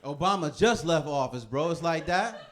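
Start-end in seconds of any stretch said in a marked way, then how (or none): none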